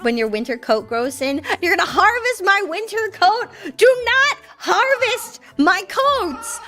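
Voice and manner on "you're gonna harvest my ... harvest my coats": high-pitched, playful indignation; mock outrage. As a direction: protest as if scandalized, pitch jumping up. Mock outrage at chat's harvest plan.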